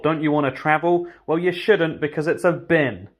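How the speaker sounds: usual speaking voice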